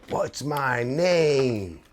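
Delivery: imitating a menacing customer, slow and high; mock aggressive taunt. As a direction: stretch each word out like a deranged stranger picking a fight. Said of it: "the guy's screaming at True Artist".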